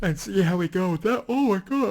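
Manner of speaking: talking through a yawn; mid-yawn, stretched out. Real, not acted. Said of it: "I'm yawning".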